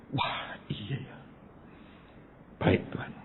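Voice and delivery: slow, almost whispered acceptance; hushed realisation. Retold or imitated. Preacher suddenly understanding and obeying God, hushed. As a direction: let it dawn softly, under the breath.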